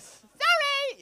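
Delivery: squeaked, very high "sorry"; high-pitched sorry. Real, not acted. Comic apologising to the room, high squeal. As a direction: pipe it up high. Calls out apology over the noise.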